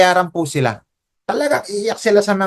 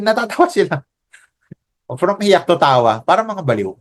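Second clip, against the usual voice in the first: usual speaking voice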